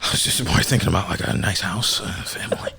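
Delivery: acting the timid child, fading to near silence; meek reply. Child explaining himself to angry mom, meek and hushed. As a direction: trail away into a small whisper.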